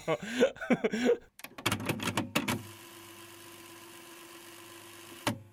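long breathless silent laugh; wheezing laugh. Real, not acted. Host wheezing with laughter after his story.

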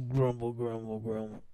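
low, soft grumble; low mock muttering; mock grumbling. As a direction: grumble under your breath, playfully. Says grumbling words in mock complaint.

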